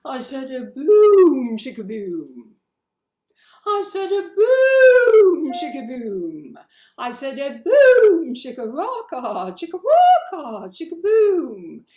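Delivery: high, loud, slow ghostly wail. Shown as a demonstration. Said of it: "ghost voice".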